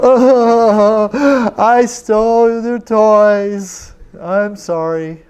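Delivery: high, slow, a boy's wailing mock confession; whimpering confession. Boy confessing to the neighbor lady, high wailing mock sob. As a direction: drag the words out like a caught kid.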